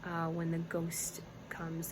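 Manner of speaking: ordinary talk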